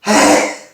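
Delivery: big explosive sneezes; loud sneezes. Real, not acted. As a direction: sneeze hard, one after another. Filming an afternoon sneezing fit.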